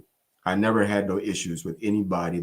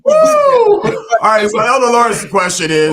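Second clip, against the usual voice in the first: loud, high hype-man whoop; excited; 14 dB louder than usual. Real, not acted. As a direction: pump the room up before the question. Host hyped reading next question.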